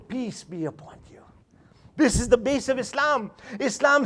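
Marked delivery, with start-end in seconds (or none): 0.1-1.2 whispered greeting. Shown as a demonstration. Greeting said tenderly.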